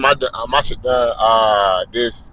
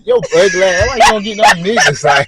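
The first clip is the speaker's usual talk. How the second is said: mock shout at her; 9 semitones higher, 5 dB louder than usual. Shouting at the giggling woman.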